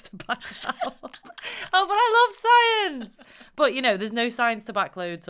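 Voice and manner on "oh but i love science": high, loud, slow gush. Acted. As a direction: gush over it like a superfan. Mock protest after own remark.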